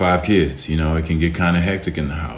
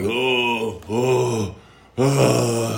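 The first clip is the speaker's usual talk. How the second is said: higher whiny "oh, oh, oh"; mock groan; 6 semitones higher, much slower than usual. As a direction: whine like a complainer. Mocking a complaining guy.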